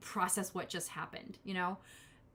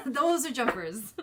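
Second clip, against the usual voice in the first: laughing; 5 semitones higher, 7 dB louder, slower than usual. Real, not acted. Laughing at cards.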